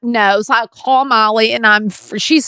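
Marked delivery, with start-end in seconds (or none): none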